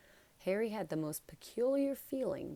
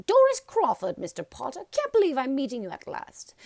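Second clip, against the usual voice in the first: put-on high, loud, gushing old lady; gushing old witch; 8 semitones higher, 11 dB louder than usual. A narrator's reading. Doris Crawford gushes at meeting Harry, high and loud. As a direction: squeal your delight like a starstruck granny.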